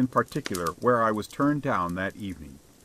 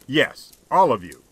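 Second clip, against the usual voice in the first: louder, emphatic affirmation; full conviction; 6 dB louder than usual. A narrator's reading. Man answering the beggar's query, with conviction. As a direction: answer with firm, heartfelt certainty. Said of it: "conviction vibrating in his voice".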